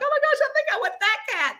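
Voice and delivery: high, fast gush; retold squeal of sudden wanting; smitten excitement. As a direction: blurt it out high and quick, lovestruck. Falling for the cat, retold.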